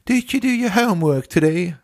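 high British teacher voice; British accent. Retold or imitated. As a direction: ask it in a prim British accent. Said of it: "mit seinem, äh, britischen Akzent".

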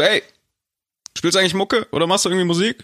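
fast, high hey-dude call. Retold or imitated. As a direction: shout it across like a stranger. Kevin ruft ihm zu. (zugerufen, schnell und hoch)

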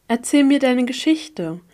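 put-on child voice. A narrator's reading. Child Kana asks for story.

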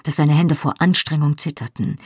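plain reading voice

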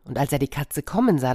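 ordinary delivery, nothing marked

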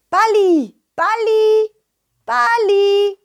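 high, drawn-out call for a lost ball. Acted. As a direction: call the name out high, as if searching.

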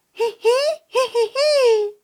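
very high, slow squeaky giggle; tiny creature giggle. Acted. Rescued Balli giggles, very high and squeaky.

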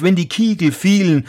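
plain reading voice

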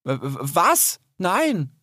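stammer, caught off guard; unsure. A narrator's reading. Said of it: "sagte Rix verunsichert". (verunsichert stotternd)

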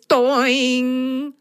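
sudden high pained yelp; ouch. Acted. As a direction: cry out as your head hits. Bumps head, ouch.

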